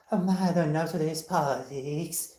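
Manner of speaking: mock the youngsters' Starbucks accent, stretching "politics". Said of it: "they call especially Starbucks effect".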